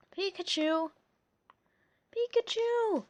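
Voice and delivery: calling out high to a runaway pet; yelling. A narrator's reading. Ash calling after his fleeing Pikachu, yelling. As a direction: cry the name after him, rising. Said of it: "Ash yelled".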